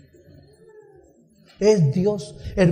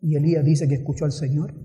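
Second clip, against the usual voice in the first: ordinary talk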